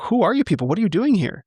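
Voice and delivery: high-pitched, swooping retold questions; incredulous questioning. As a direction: act out baffled questions to strangers. Retold puzzled questioning of strangers.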